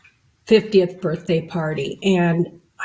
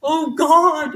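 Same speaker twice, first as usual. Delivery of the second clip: mock dramatic; 7 semitones higher than usual. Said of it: "I don't need to be like".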